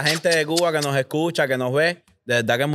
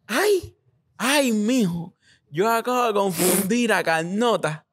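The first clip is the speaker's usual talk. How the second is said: a mother's higher, startled voice, lightly done; imitating his flustered mom; 7 semitones higher, slower than usual. Mom realizing she confused Carnota, higher and flustered. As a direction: play the mom realizing her mix-up. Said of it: "reinicia Windows y dice".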